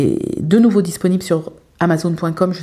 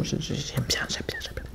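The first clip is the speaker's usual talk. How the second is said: whispered; 3 semitones lower, 14 dB quieter than usual. Real, not acted. Trailing murmured admiration.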